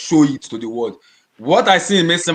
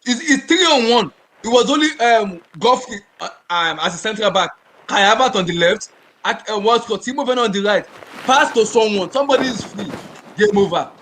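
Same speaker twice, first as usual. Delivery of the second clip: worked-up retelling, pitched higher; angry; 6 semitones higher than usual. Retold or imitated. Fan recalling a wasted three-on-one chance, angry. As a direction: relive the anger, urgent and exasperated. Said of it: "I was angry because I was like".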